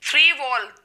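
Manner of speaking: ordinary talk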